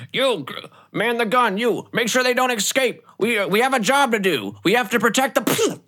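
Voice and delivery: high, urgent captain's shouting; shouted commands. Acted. Captain ordering guards to man the gun, shouting commands. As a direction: bark orders at the crew in a high yell. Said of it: "You hear him shouting off commands".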